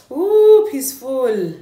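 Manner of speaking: high, loud squeal of pleasure then a sighing peaceful; delighted ooh. Real, not acted. Vlogger feeling the mask on her face, delighted high squeal. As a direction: squeal at the cool feel.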